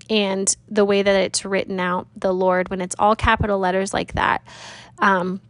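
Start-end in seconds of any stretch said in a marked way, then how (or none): none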